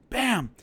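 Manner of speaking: a sharp, loud high "Bam!" for the last blow; imitated punch impact; punch sound effect. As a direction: smack the word out like a landed punch. Acting out the final punch.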